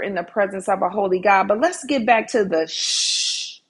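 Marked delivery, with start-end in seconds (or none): 2.7-3.6 long, soft "shh"; whispered shush. Shown as a demonstration. Preacher returning to God's whisper, with a long hushing shush. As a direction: hush them with a drawn-out shush. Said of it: "It's in the whisper".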